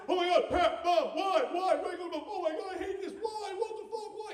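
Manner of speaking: high, loud mock-toddler meltdown; imitated screaming tantrum. Three-year-old given regular milk, hammed-up high screaming. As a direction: wail in hammed-up outrage at the injustice. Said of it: "She's just screaming".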